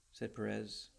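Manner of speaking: ordinary delivery, nothing marked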